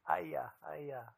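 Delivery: put-on light whimpering kid's voice; sick four-year-old crying that it hurts, lightly imitated; imitating a crying child. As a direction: whine it like a sick little boy. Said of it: "He's crying".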